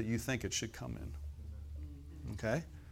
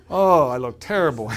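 loud, laughing mock dismay; 11 dB louder than usual. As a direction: groan at your own photo, laughing. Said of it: "Your eyes go right to".

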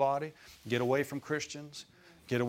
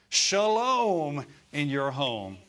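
slow, louder proclaimed blessing; drawn-out blessing; 2 semitones higher, 5 dB louder, much slower than usual. Real, not acted. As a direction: stretch each word out as a blessing. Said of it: "blessing proclaimed slowly".

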